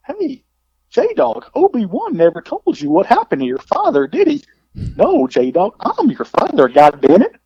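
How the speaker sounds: high mock Rusty voice with wild pitch swings; goofy impression of a friend reciting a movie line; Rusty Brooks impression. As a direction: ham up the buddy's iconic voice. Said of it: "doing the whole Rusty Brooks".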